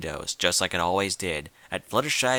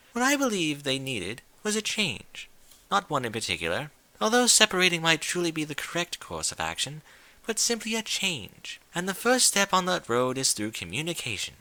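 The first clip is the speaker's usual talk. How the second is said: higher, elegant ladylike voice; refined lady; 6 semitones higher than usual. Acted. Rarity gently advising her friend, in a refined voice. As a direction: speak as a gracious, genteel lady.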